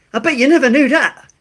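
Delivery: high, loud goofy voice; high put-on voice. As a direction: squeak it out playfully. Catchphrase in a put-on voice.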